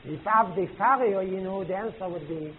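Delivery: usual speaking voice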